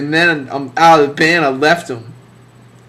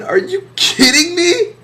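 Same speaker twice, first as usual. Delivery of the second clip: squeaky, far higher "are you kidding me"; mock disbelief. Scoffing at a friend's distortion pedal, mock disbelief. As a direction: jump way up in fake outrage. Mocking friend's gear choice.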